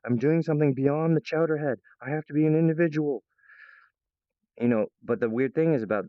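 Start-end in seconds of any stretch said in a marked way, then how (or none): 0.0-3.2 a touch higher, earnest mock proclamation; lightly voiced self-pep talk; willful declaration. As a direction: announce your individuality to yourself. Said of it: "you have to actually willfully say".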